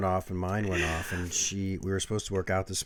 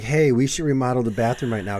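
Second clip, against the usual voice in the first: mock bossy partner; 4 semitones higher, 6 dB louder than usual. Imitates partner ordering him around.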